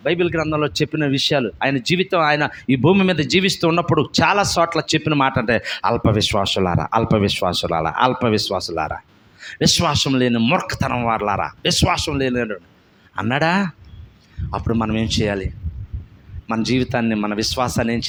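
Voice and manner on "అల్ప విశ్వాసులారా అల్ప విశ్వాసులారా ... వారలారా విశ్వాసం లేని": Jesus rebuking his faithless disciples. Acted. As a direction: lower grave rebuke.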